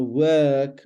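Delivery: elongated middle in WORK; long vowel. Shown as a demonstration. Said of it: "it's a long one".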